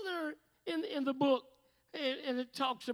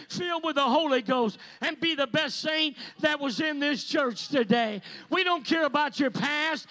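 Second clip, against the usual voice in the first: louder, driving altar call; preaching at full force; 4 semitones higher, 14 dB louder than usual. Real, not acted. Preacher calls sinners to the altar, shouting. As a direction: push them toward the altar, full voice.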